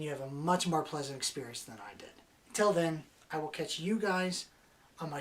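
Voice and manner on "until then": usual speaking voice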